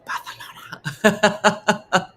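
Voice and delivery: slow drawn-out whisper, "Barcelona" with a th; whispered lisped Barcelona. Shown as a demonstration. Showing how it has to be said, slow lisped whisper. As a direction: whisper "Barcelona" slowly, lisping the c. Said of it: "You have to whisper it".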